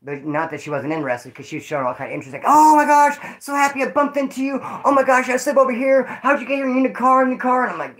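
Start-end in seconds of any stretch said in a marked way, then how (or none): 2.4-7.6 high, excited mock female voice; imitated gushing greeting. Chick bumping into him on the streets, mock gushing. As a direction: squeal a hammed-up happy hello.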